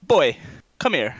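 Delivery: high, loud, falling call; finger-snapping summons in character; Walter summoning. Acted. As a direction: call the boy over sharply. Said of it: "Walter goes, he snaps his fingers, he goes".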